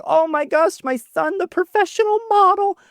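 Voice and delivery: high doting mother praising her son; gushing mom. Retold or imitated. Mom imitated proudly.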